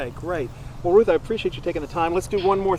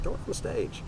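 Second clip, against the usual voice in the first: whispered urging; hushed, sneaky suggestion; whispering; 9 dB quieter than usual. Retold or imitated. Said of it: "I said".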